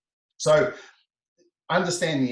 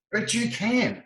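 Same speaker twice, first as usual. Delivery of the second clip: much higher, insistent re-enactment; retold insistence; 11 semitones higher, slower than usual. Trainer tells the patient they can cry, retold high insistence. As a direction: act out contradicting someone, pitched right up.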